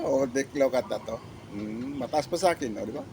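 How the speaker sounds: usual speaking voice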